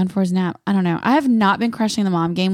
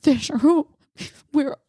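panic crying; 7 semitones higher than usual. Retold or imitated. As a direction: call out through mock tears. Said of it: "but I'm crying and I'm like".

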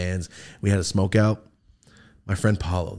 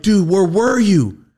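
loud, high, drawn-out disbelief; acted incredulous question; 10 semitones higher, 7 dB louder, much slower than usual. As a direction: demand an answer in mock astonishment. Acted questioning of drunk friend.

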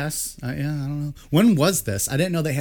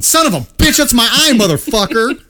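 much higher, loud pained cursing; angry yell; 9 semitones higher, 8 dB louder than usual. Retold or imitated. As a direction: yell after getting hit in the eye. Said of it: "And Dave going".

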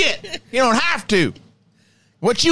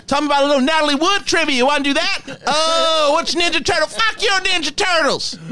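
higher, ranting troll voice, insulting the fan; Professor Crow rant; 4 semitones higher than usual. Pretend. Professor Crow berating a Star Wars fan, higher ranting voice. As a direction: tear into him like a mean cranky professor. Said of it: "Professor Crow needs its own Patreon".

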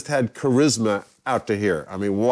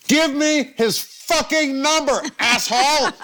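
high, slow yelled demand; hammed-up furious demand; 13 semitones higher, slower than usual. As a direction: bellow it word by word, playing exasperation for laughs. Acting out his furious phone demand.

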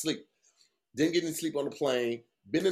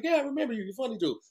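lightly voiced clerk, pitched higher; a hint of a cheerful desk clerk; hotel clerk imitation; 9 semitones higher, much faster than usual. Hotel clerk recognizing a returning guest, mock friendly. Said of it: "She was like".

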